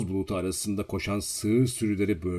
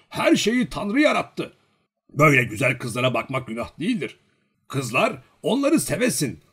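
put-on higher, louder rhetorical old man; impassioned old villager; 6 semitones higher, 5 dB louder than usual. A narrator's reading. Old man persuading Olenin that love is no sin, fervently. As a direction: argue it with rising fervour.

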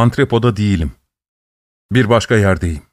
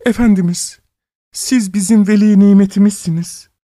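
high, whining tearful plea; 9 semitones higher than usual. A narrator's reading. Old woman under the window flattering her master, tearful. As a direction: plead as if about to cry. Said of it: "ağlamaklı bir sesle".

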